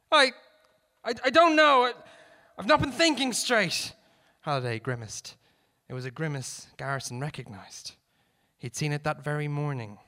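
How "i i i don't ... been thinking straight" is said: loud, high, stammering reply; flustered shouting. A narrator's reading. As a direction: shout back in confusion. Cornered gunman answers, shouting back.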